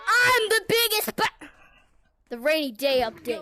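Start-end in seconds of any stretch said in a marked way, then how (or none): 0.1-1.5 yelled catchphrase. Real, not acted. Kid yelling catchphrase in game.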